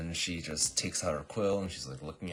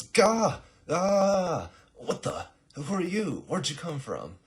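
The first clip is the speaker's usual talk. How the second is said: high, loud mock fright; yelp, then demand answers in alarm; startled yelling; 13 semitones higher, 10 dB louder, slower than usual. Assassin surprised by girl from bushes, loud acted startle.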